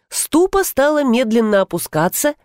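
plain reading voice

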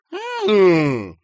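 loud, high drawn-out "aah" of delight; sweet welcoming cry; sugary exclamation. A narrator's reading. Boss fawning on his visitor, sugary exclamation. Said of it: "вскричал он сладко".

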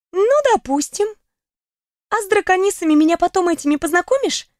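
высокий голос подруги; спокойно принять; подруга. Acted. Голос Даяны. (голос подруги)

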